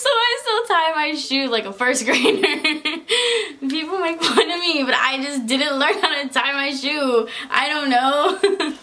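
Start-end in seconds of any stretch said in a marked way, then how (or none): 2.4-3.7 short, high laugh; a quick embarrassed laugh; giggle. Real, not acted. Laughing after the first grader line, short giggle. 7.5-8.7 slow, drawn out through a laugh. Real, not acted. Giving up on explaining her shoes, laughing.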